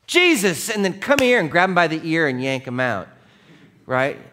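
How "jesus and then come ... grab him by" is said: high, loud scolding yell of the name; a mother shouting "Jesus" at her runaway son; angry yell. Retold or imitated. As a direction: yank the boy out with a yell. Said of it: "Mary didn't be like".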